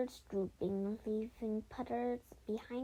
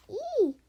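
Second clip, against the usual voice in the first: very high, loud squeal; shrill loud "eee"; shrieked "ee"; 13 semitones higher, 9 dB louder than usual. A narrator's reading. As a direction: let out a piercing acted shriek.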